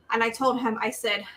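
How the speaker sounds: ordinary talk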